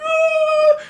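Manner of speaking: imitating a scared yelp; mock panicked scream. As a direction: squeal like a hiding kid. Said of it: "I was like".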